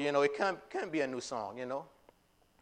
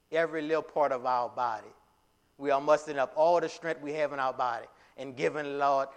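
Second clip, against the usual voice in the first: normal talking voice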